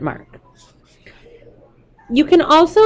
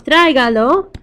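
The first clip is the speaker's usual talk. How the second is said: "Tráigalo" stressed on the first syllable, trái; 3 semitones higher than usual. Shown as a demonstration. Teacher saying it aloud so students hear the stress on trái. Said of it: "hear that it goes on the A".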